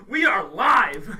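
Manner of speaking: announcer shout. Acted. Announcing the show is live.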